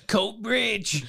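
high, drawn-out ring announcer call; mock fight announcer, pitched well up; Bruce Buffer announcing. Podcaster imitating a fight announcer introducing Paul Craig, drawn-out ring call. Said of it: "Bruce Buffer, announce that he's for Coatbridge. What's it like?".

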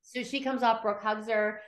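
usual speaking voice

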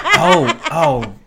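slow, sinking comic realisation; mock dawning dread. Actor realising the whole film is sung, mock dread.

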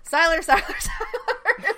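high, loud, laughing call of the name Siler; hammed-up urgent calling out, half laughing; mock insistent shouting of Siler with laughter breaking in; mock frantic calling. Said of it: "he has a bee in his bonnet".

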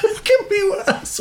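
high, wheezy laughing repeat; incredulous words through laughter; laughing echo. Real, not acted. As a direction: repeat it while cracking up. Cracking up at friend's remark.

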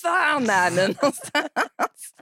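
högre, utdraget och upprört på FAN; hetsigt telefonskäll. Retold or imitated. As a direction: spela upp ilskan i luren. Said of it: "Så jag börjar ju hetsringa". (hetsigt telefonskäll)

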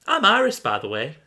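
high, loud eager hello; bright introduction as Iris. Acted. Iris introduces herself to the stranger, high and loud. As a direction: announce your name with a lift. Acted story dialogue, introduction.

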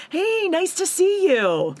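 a sing-song politeness that sounds forced; fake-polite greeting. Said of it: "Leah's like".

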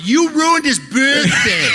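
slow, high mocking accusation; accusing crowd. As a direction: imitate the crowd's reproach. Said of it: "People are going".

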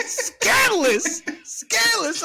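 high, loud, drawn-out gasp of scandal; mock outrage. Real, not acted. Reacting to her friend using her, high and squealing. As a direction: squeal it out in shock. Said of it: "reacting to being used".

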